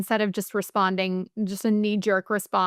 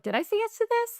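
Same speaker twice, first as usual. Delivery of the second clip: much higher, puzzled question "Did I say yes to this?"; confused self-quote; 12 semitones higher than usual. Retold or imitated. To herself after agreeing too fast, high puzzled mock disbelief. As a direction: ask yourself in mock disbelief.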